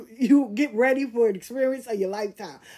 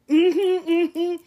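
high sing-song mm-hmm; insincere agreement; 6 semitones higher, 4 dB louder than usual. Retold or imitated. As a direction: brush off the usher with a mm-hmm. Fake agreeing with usher.

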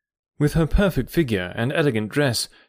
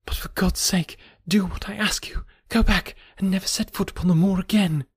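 higher, hushed and desperate woman's plea; pleading warning; 6 semitones higher than usual. A narrator's reading. Woman begs the visitor to flee the moor, a hushed plea. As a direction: beg him in a near whisper to leave.

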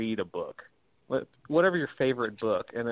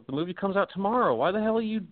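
much higher, incredulous scolding of spoiler hunters; lightly hammed-up disbelief; mock exasperated rant; 10 semitones higher than usual. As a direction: throw up your hands at the spoiler seekers in a higher pitched mock rant. Imagined rant at spoiler seekers.

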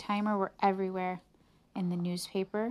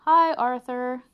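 Mister Sipple; 5 semitones higher, 9 dB louder, slower than usual. A narrator's reading. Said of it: "he said".

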